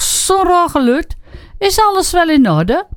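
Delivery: high, lightly voiced policeman; a touch of a puzzled night cop. A narrator's reading. Agent at the door asks if all is well, lightly voiced.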